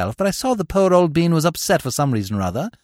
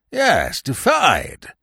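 "defied" repeated firmly, with raised voice; overwrought old man; much slower than usual. A narrator's reading. Old uncle insisting he was defied, overwrought and emphatic. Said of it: "in a rather overwrought way".

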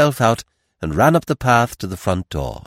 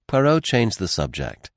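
ordinary delivery

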